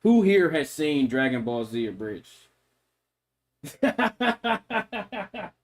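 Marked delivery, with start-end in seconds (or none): 3.8-5.5 high, breathy villain laugh; devious laugh. Acted. Streamer showing a devious voice, villain laugh. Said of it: "one of my devious voices".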